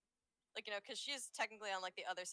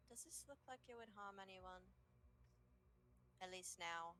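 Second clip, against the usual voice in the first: much softer, hushed reply; 14 dB quieter than usual. Acted. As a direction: keep it down so the baby sleeps. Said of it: "with a softer voice, as to not disturb the baby".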